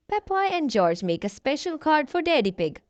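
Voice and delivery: plain reading voice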